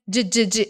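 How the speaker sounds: "D, d, d" as repeated short j of jeep; DJ sound. Shown as a demonstration. Teacher isolating a sound, "D, d, d" said as quick dj. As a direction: pop out "D, d, d" as dj bursts. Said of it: "esse som".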